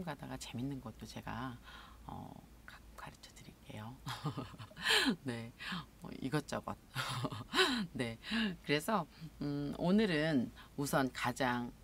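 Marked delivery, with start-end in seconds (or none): 4.0-5.2 airy, half-whispered laugh; breathy chuckle. Real, not acted. Host after promising viewers fun extras, breathy chuckle. 6.8-7.9 short, higher-pitched chuckle; light laugh. Real, not acted. Host after a vague list of topics, light laugh.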